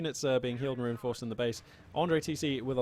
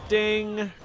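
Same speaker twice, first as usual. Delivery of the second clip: high, loud ding sound effect; imitated ding; 7 semitones higher, 6 dB louder than usual. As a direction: chime out a ding like a hit. Imitating a shot ringing off armor.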